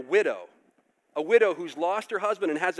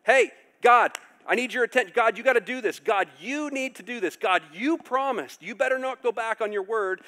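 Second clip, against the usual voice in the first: bold demand; 6 semitones higher, 5 dB louder than usual. Retold or imitated. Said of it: "they said things like".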